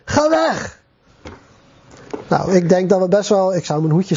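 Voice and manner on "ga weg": high-pitched mock yell, no real volume; acted high outcry; mock shout. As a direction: cry it out high, as the possessed man. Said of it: "En die schreeuwde".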